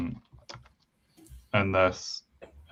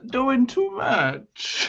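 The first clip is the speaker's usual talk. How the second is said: high, slow put-on Boston accent; 14 semitones higher, much slower than usual. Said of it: "I was trying to do Corey King's Boston accent".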